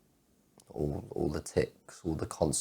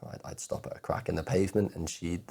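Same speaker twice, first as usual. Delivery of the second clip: ordinary talk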